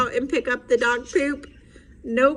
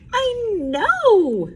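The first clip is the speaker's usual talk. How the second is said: Froggy voice; 8 semitones higher, 4 dB louder than usual. Acted. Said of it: "Froggy answers".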